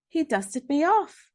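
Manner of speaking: plain reading voice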